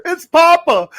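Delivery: high, loud laughing yell; dad calling out. Retold or imitated. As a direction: holler it like an embarrassing dad. Imitating dad again.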